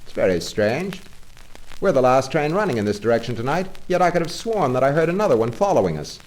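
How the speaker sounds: a puzzled driver hurrying out his worry; puzzled engine driver. Acted. Engine driver telling Toad about the train behind, puzzled and fast. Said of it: "said to Toad".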